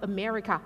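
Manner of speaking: "America" with weak uh vowels at both ends; English schwa pronunciation. Shown as a demonstration. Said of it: "ไม่ใช่อเมริกา".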